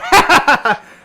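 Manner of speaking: put-on big high cackle in the old man voice; hammed-up old man cackle; loud cackle. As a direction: cackle at your own joke. NPC laughs at own joke.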